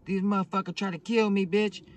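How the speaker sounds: loud, high retold complaint; imitated yelling woman. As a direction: act out a beaten-up friend yelling her grievance. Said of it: "She's over here yelling and shit".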